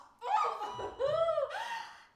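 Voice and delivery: giddy laugh. Acted. Swooning over him.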